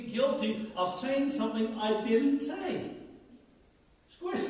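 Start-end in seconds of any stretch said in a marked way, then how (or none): 4.1-4.4 "Squish" said high and crisp; model word "squish". Shown as a demonstration. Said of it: "Say it".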